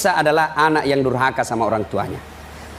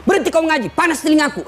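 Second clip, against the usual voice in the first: mock angry yell; 8 semitones higher than usual. Dying man yells at reciter.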